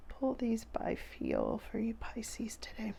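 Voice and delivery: slow, soft whisper as the cards are drawn; hushed ritual whisper; whisper while pulling. Real, not acted. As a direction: breathe the words while focusing.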